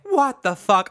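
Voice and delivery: retelling an indignant outburst; retold outrage. Storyteller to cohost after being hit by a child, indignant.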